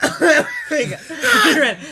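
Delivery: laughing through the words; breaking into laughter mid-sentence. Real, not acted. Laughing while retelling running away.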